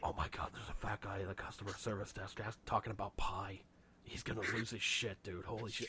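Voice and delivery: hushed unvoiced whisper, gossiping. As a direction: whisper it behind his back. Imitated bystander filming secretly.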